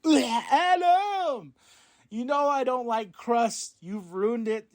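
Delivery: very high, slow drawn-out whine on Ugh, Adam; whiny mock Roderick. Roderick whines to Adam about the crusts in a high, slow voice.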